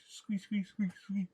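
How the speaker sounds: high squeaky wheel noises; rubbery high squeaky toy. Retold or imitated. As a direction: squeak like a little tricycle. Puppet on a squeaky tricycle.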